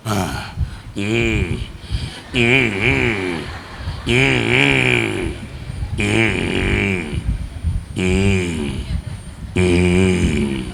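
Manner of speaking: put-on very slow, soft, low hums of a child falling asleep; drowsy soft hums. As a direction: hum softly as if dozing off. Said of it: "నిద్రలోకి వెళ్ళిపోతాడు మెల్లగా".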